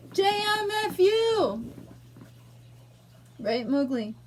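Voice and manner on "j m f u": high, drawn-out angry exclamation; angry outburst. Real, not acted. Showing an angry emotion to the vlog, angry. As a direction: let the anger out, stretched and high. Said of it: "this one's an angry emotion".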